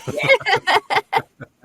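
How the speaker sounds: big high laugh, loud; loud burst of laughter. Real, not acted.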